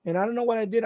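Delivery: ordinary delivery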